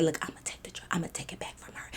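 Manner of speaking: secret unvoiced whisper. Whispering to the girl behind her drunk friend's back. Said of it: "I'm whispering to the girl".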